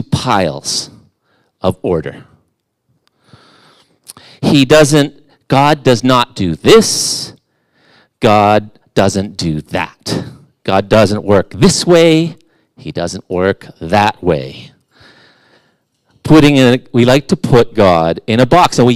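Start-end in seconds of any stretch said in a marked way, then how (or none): 4.4-14.7 mock rules. Mocking rigid rule-making about God.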